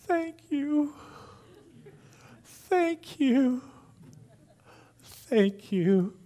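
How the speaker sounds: the girl thanking her mom through happy tears, imitated; tearful thanks. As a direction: imitate a little girl crying with joy. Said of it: "she starts crying".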